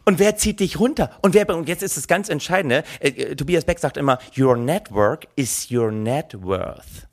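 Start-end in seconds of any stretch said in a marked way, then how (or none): 4.4-7.1 very slow, low guru quote; mock-profound motto. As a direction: spell it out like deep wisdom. Zitiert feierlich den Motivationsguru. (bedeutungsschwer, sehr langsam und tief)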